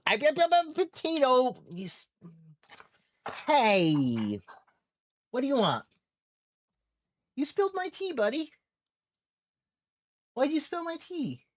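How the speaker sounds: high, soft pet talk; dog voice. Pretend. Owner talks to her dog in a high pet voice. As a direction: play at scolding the dog.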